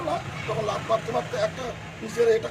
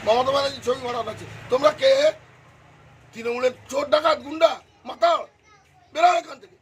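louder, rising indignation; retold angry outburst; 3 semitones higher, 5 dB louder than usual. House owner retelling how he confronted party intruders, angry outburst. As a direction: build to a mock order to get out.

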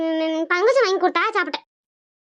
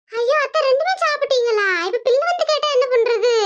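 put-on higher, worried young wife; anxious daughter-in-law pleading with mother-in-law; 6 semitones higher than usual. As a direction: fret to your mother-in-law.

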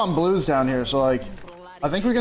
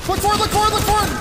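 higher, louder urgent callout; urgent callout shout; 12 semitones higher, 4 dB louder than usual. Real, not acted. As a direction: call it out loud and fast.

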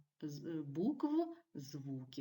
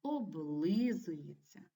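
"Облизується" slowly, drawing out the stressed "ли"; stress on second syllable; much slower than usual. Shown as a demonstration. Teacher shows pupils second-syllable stress, "Облизується" slow with a long "ли". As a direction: stretch and lean on "ли" in "Облизується". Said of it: "на другому складі".

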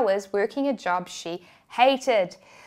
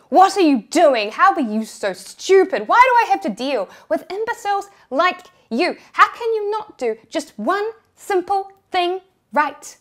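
loud, higher furious tirade; screaming manager; 7 semitones higher, 6 dB louder than usual. Retold or imitated. Manager berating the cashier in front of everyone, furious yelling. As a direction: tear into her with rising fury. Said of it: "she screamed at me".

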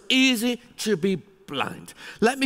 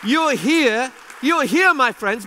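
big, loud and high, lifting the room; rally the crowd with a ringing welcome; rousing call; 6 semitones higher, 5 dB louder, slower than usual. Real, not acted. Keynote speaker rallying the audience, in a loud rousing call.

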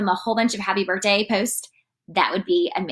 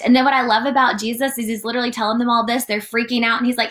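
ordinary talk

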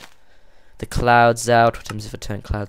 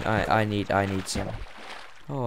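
ordinary delivery

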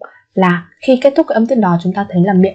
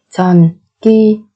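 syllable by syllable; faster than usual. Shown as a demonstration. Teacher models word syllable by syllable.

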